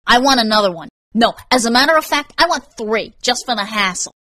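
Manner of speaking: pushy angry customer; irate demand. Acted. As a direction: push the clerk, indignant and forceful. Irate customer makes further demands.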